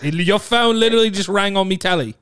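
insistent put-on British accent. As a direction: accuse in casual British slang and accent. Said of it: "you have to do British accent".

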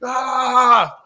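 high loud victory yell; loud high shout of victory; triumphant roar. Retold or imitated. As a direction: let out a wild winner's roar. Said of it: "He's spitting all over the place".

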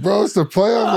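high-pitched mock quote of Justin Thomas. Teammate at the free throw line, imitated with a high voice. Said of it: "he's just like".

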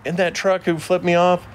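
high-pitched put-on Southern accent. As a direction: slip into a hammed-up Southern twang. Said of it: "I think Southern accents are cool".

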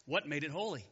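normal talking voice

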